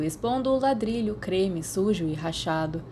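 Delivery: plain reading voice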